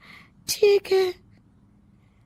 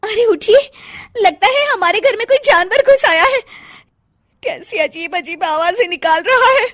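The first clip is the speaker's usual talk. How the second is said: high, loud panicked waking of husband; scared wife whispering-turned-crying alarm; frightened wife; 7 semitones higher, 6 dB louder than usual. A narrator's reading. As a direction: shake him awake in fright. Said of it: "घबराकर अपने पति नकुल को जगाती है".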